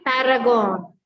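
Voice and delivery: "paragon" said slowly with the R sounded; deliberate "paragon", voicing the middle R; R pronounced. Shown as a demonstration. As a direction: model "paragon" with a clear R. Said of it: "here it will be pronounced".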